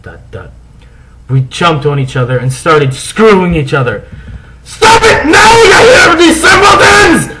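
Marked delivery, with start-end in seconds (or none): 4.6-7.3 very high, loud scolding shriek; furious shout. Acted. Teacher catching the pair, shrieking angrily. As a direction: shriek at the kids like a strict teacher. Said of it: "shouted Professor McGoogle".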